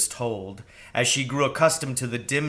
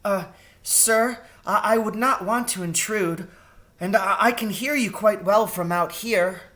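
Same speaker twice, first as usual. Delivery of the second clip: high, hesitant mouse voice; a small mouse wary of the owl; timid mouse, higher; 9 semitones higher than usual. Acted. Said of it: "she said timidly".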